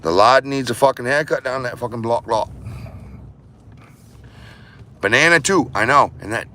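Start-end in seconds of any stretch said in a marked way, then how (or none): 0.0-2.5 lighter, drifting Aussie bloke voice; mock Aussie accent. Still joking in a loose mock Aussie accent.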